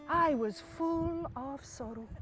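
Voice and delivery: soft, slow, drawn-out lament; mock sorrow. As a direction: stretch it mournfully and quietly. Husband claims sorrow at missing.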